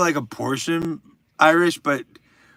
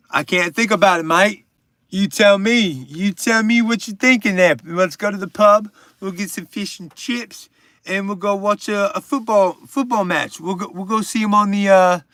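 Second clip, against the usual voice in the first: higher, louder mock British accent; bad British accent; 5 semitones higher, 4 dB louder than usual. Streamer joking with a British viewer, bad British accent. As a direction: ham up a clumsy British bloke accent. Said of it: "that's a bad British accent".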